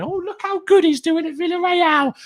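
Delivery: high mocking voice of critics. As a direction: sneer it in a high squeaky voice.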